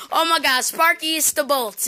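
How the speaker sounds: ordinary talk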